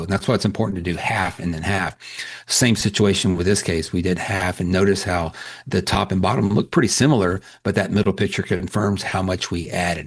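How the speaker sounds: normal talking voice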